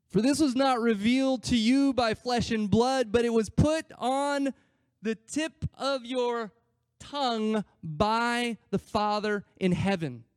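high, loud, slowing declaration; emphatic proclamation. Retold or imitated. Jesus blessing Peter for his answer, emphatic proclamation. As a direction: proclaim it, stretching the phrases out slowly.

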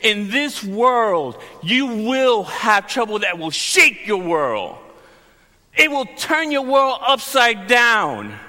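slow, declaiming preacher voice, building; preaching Jesus' words. Acted. Pastor voicing Jesus to the congregation, slow solemn preaching. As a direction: proclaim the verse, drawn out. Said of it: "Jesus is saying".

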